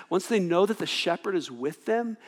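normal talking voice

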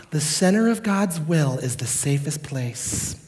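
a hint of a sweet grandma; grandma saying. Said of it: "has anyone's grandma ever told them".

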